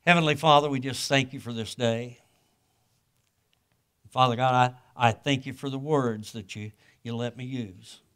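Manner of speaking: humble, earnest prayer to God; closing prayer. Acted. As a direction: pray sincerely over the congregation.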